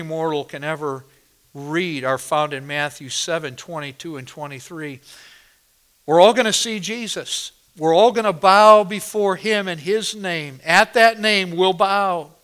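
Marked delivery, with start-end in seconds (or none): 6.1-12.3 loud, ringing pulpit delivery; thundering sermon voice; full-force preaching. Acted. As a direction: proclaim it to the whole congregation.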